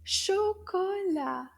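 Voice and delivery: "Chocolat" slow and high, each syllable stretched; slow recap. Shown as a demonstration. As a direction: draw out "Chocolat" for learners to follow. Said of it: "Did you remember your new word?".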